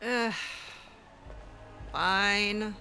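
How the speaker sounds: ordinary talk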